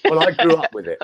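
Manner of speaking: lively homegrown Cockney; Cockney accent. Shown as a demonstration. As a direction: show off a real London Cockney. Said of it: "a real Cockney accent".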